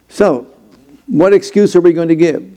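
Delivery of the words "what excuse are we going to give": ordinary delivery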